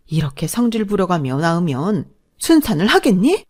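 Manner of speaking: high exasperated groan, then scornful threats; vent, then threaten her; seething mother-in-law. Acted. Mother-in-law threatening her pregnant daughter-in-law, fuming.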